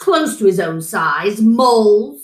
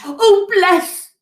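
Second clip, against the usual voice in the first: higher, pleading fox voice; fox crying out; 5 semitones higher than usual. A narrator's reading. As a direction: gush gratitude in a put-on fox voice. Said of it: "he cried".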